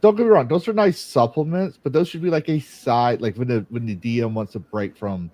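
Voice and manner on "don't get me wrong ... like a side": higher, louder insistence; emphatic. Real, not acted. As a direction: push the point hard. Emphatic argument.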